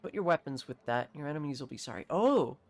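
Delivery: normal talking voice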